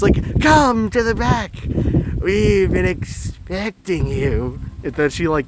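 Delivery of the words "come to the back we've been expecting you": high, slow, eerie beckoning; deranged cultist voice, stretched out; creepy splicer-like woman. Retold or imitated. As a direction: lure them in with drawn-out words. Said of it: "like the splicer".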